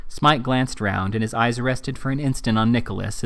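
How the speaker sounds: ordinary delivery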